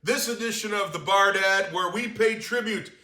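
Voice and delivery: high, loud, upbeat host welcome; show host intro. Acted. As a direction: greet the audience like a TV host.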